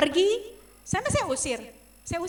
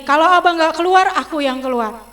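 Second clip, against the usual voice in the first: angry shout; 4 semitones higher, 12 dB louder than usual. Retold or imitated. Quarrel with husband in car.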